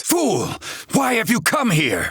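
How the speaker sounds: sharp high outburst at an intruder; angry rebuke. Acted. Scolding an unwelcome visitor, high and angry. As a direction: bite out the insult, then demand answers.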